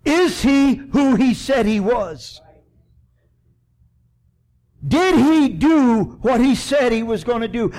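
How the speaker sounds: high, loud preaching climax. Acted. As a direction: preach it out with full force. Preaching at full force, raised voice.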